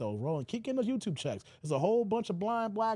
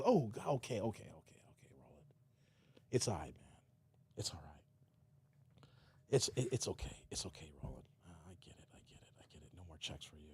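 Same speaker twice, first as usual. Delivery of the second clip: softer and softer until whispered; fading to a whisper; 11 dB quieter, faster than usual. Retold or imitated. Host soothing Roland, trailing into a whisper. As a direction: hush him down to a whisper.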